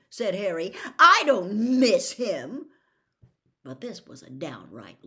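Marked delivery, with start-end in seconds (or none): 1.0-2.7 slow, loud insistent denial. A narrator's reading. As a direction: insist hard, almost shouting. Harry denies it loudly.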